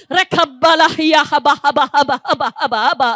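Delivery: rapid babbling syllables, pitch sliding down each run; speaking in tongues. Real, not acted. Preacher leading the congregation in tongues, ecstatic babble.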